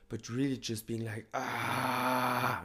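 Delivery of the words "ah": high, sustained "aah", restrained; half-strength scream for demonstration; held-back demo scream. Shown as a demonstration. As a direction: scream it out without full volume. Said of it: "not gonna do it here in the proper way".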